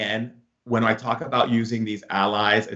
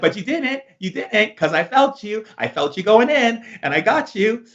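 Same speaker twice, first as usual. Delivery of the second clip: higher, gloating comeback; playful triumph; 11 semitones higher than usual. Retold or imitated. Telling his girlfriend he felt her coming, with gloating glee. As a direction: crow back that you caught her.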